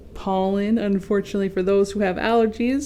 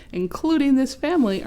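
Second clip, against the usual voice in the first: ordinary delivery, nothing marked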